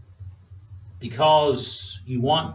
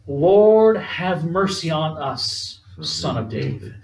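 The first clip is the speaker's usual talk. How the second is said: preacher's slow cry for mercy, fading at the end; 3 semitones higher, slower than usual. Retold or imitated. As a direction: call out the first word, let the plea fall away. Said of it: "These two men cry out".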